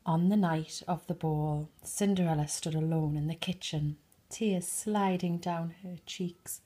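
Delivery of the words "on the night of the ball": plain reading voice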